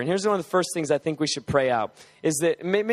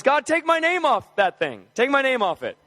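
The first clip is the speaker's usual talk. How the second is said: higher, lifted preaching prayer; rising preaching prayer; 8 semitones higher than usual. Shown as a demonstration. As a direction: lift the same prayer up into preaching. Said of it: "So just say it in your own words".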